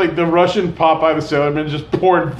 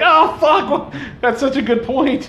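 high, laughing oh fuck; mock realization; 9 semitones higher, slower than usual. Laughing at Americans being outsmarted, high laughing. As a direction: fake dawning shock through laughter. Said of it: "You're like".

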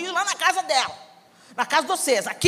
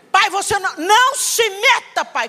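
higher, louder, slow and defiant; disrespectful yelling; 7 semitones higher, 5 dB louder than usual. Retold or imitated. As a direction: put your father in his place. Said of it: "Comecei a desrespeitar o meu pai".

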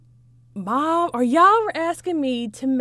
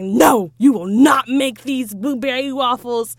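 mock angry; 7 dB louder than usual. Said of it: "I was pissed".

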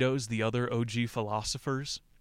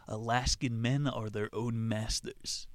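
normal talking voice

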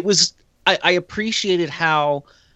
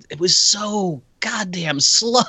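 drawn-out slow; 5 semitones higher, slower than usual. Shown as a demonstration. Host griping about a sluggish scene, in an exaggerated slow drawl. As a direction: stretch the words out, higher pitched.

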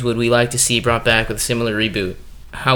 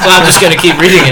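loud, fast, laughing declaration; talking through laughter; 4 semitones higher, 9 dB louder, faster than usual. Real, not acted. Reader carries on with the prompt, laughing hard. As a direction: push on while cracking up.

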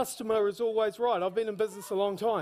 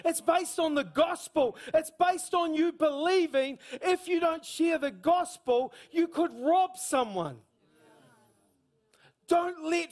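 raised, ringing preacher voice, driving each line home; preaching at full force; 6 semitones higher than usual. Acted. Preacher urging the congregation to share the gospel, full force.

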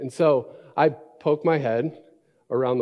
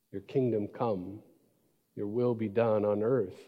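measured, reverent Bible reading; scripture reading. Shown as a demonstration. Pastor reading Matthew six aloud to the church, reverent.

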